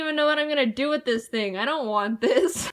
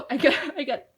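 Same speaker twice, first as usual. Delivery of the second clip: flustered gasp; 3 semitones higher than usual. Real, not acted. Just jump-scared by toy.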